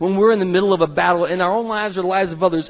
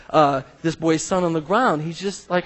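normal talking voice

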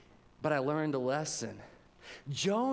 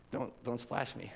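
whispered, much softer fatherly warning; dad's hushed warning; 6 semitones lower, 6 dB quieter than usual. Retold or imitated. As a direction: hush it like a dad warning his kid.

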